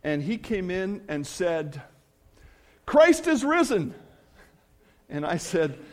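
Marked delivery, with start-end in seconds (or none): 2.8-3.9 loud, high-pitched imitation of an excited friend; booming, exuberant greeting; enthusiastic proclamation. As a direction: burst out with joyful news, imitating him. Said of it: "he's a real enthusiastic guy".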